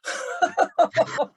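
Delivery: a real high breathy burst of laughter; high airy laugh. Real, not acted. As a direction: laugh out high and airless at the insult. Laughs at the review.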